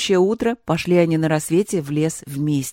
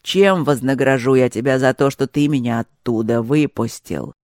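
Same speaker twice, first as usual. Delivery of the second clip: terrible monster voice; 5 semitones lower than usual. A narrator's reading. Monster menacing the youth in a lower, dreadful voice. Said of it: "воскликнуло чудовище страшным голосом".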